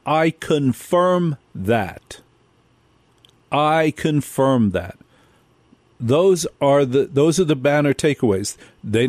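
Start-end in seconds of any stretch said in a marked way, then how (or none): 0.1-5.0 very slow, drawn-out quoted line; deliberate, weighty repetition of a quote; emphatic quote. Retold or imitated. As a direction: stretch each word to drive it home.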